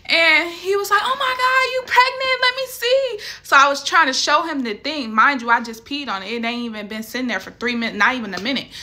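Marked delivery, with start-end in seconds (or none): 1.0-3.2 much higher, mock astonished brother; hammed-up gasp of a surprised brother; shocked brother. Brother reacting to sister's positive test, mock shocked and high. Brother voiced in retold dream.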